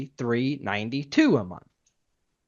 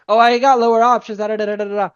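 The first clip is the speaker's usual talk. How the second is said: higher and louder, mocking a desperate rep; mocking panicked rep; 8 semitones higher, 7 dB louder, faster than usual. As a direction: ham up the panicked pitch. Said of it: "now you're freaking out".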